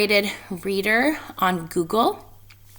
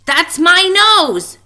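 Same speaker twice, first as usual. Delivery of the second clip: high, loud, slow squeaky protest; squeak; 9 semitones higher, 9 dB louder, slower than usual. Acted. Shrew squeaking at the animal who grabbed his nose. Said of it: "Shrew squeaked".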